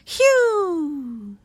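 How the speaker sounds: high falling whistled "whew"; mystery bird call. Acted. Narrator imitating the strange bird call.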